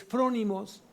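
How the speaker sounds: frónimos stressed on the first O, FRÓ-ni-mos; Greek word pronounced. Shown as a demonstration. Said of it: "acento en la O".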